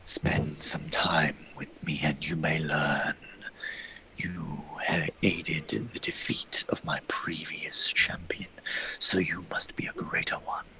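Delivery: slow, low unvoiced sinister whisper; eerie whisper. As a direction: tempt them in a cursed whisper. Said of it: "this eerie voice in the back of your head".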